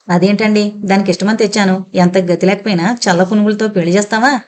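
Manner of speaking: plain reading voice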